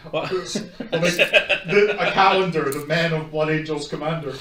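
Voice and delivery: giggling while teasing; laughing. Real, not acted. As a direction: joke through laughter, loud.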